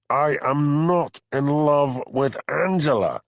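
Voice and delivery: slow, loud anguished protest; howled. Acted. Hamish howling his denial at Harry Bain, loud and slow. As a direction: howl the denial, dragged out. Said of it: "howled Hamish".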